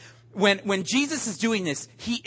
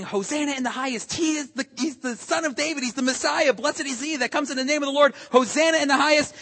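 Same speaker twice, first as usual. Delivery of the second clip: higher, quick rush of acclaim; excited crowd cry; 6 semitones higher, faster than usual. Retold or imitated. Preacher voicing the crowd hailing Jesus, quick and raised. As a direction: cheer him in as Messiah. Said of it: "It says they were screaming".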